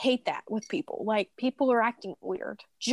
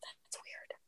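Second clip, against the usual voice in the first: unvoiced whisper to herself; whispered aside; 22 dB quieter than usual. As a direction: whisper it under your breath, puzzled. Aside while doubting mom.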